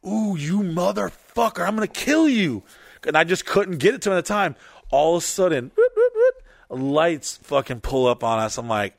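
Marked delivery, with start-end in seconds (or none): 0.0-2.6 angry threat. Retold or imitated. Bleeding rider to the friend who kicked him, acted angry threat. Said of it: "I'm angry". 5.8-6.4 very high, loud siren whoops; imitated siren. Police pulling up on the car, mimicked siren whoops. Police lights suddenly pull up.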